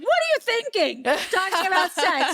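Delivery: disapproving dad. Retold or imitated. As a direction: scold the daughter in mock outrage. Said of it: "He's like".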